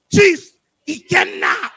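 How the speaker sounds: loud, slow chant; loud call. Shown as a demonstration. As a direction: call the name out big. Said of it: "but with your African accent".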